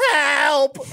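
high, strained cry of help; mock yell for help. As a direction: call out for help from the ditch. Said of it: "my first instinct was just to yell".

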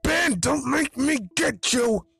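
high, loud, drawn-out threat; yelling. A narrator's reading. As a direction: shout a slow warning to a runaway. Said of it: "you hear a deep voice yell".

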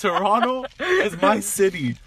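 slow, high, loud territorial boast. Acted. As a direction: stake your claim, drawing the words out. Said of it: "this is my, what'd you say? My higher ego talking".

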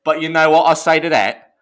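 hammed-up British accent; fake British accent. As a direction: put on a cocky Brit voice. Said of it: "I'll do a British accent".

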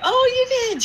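very high imitated squeak. Medium to client who saw her show, nervously high. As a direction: act caught out, far up in pitch. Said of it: "as my voice gets higher".

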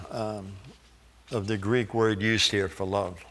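ordinary talk

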